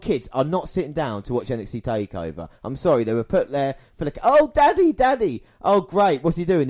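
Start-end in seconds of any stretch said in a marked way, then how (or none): 4.2-5.4 put-on high squeaky kid voice; mock child voice. Kids in crowd mocked. 5.6-6.7 put-on high, louder child voice; lightly voiced excited kid; mock kid voice. Kids in crowd mocked.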